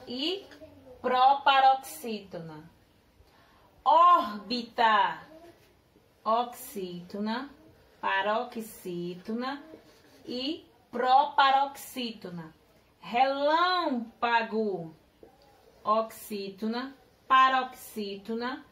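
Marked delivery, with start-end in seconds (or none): 3.8-5.2 "Órbita" said slowly, stretching the stressed "Ór"; drawn-out example word. Shown as a demonstration. Teacher asks pupils to classify "Órbita" by stress, said slowly, stretched. 13.0-14.9 "Relâmpago" said very slowly, stretching the stressed "lâm"; drawn-out example word. Shown as a demonstration. Teacher asks pupils to classify "Relâmpago" by stress, said very slowly, stretched.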